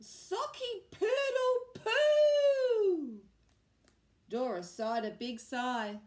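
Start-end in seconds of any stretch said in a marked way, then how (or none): 0.0-3.2 high, loud, slow garbled crow; botched crow again. A narrator's reading. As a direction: try the crow once more and fail. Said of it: "Rupert tried again".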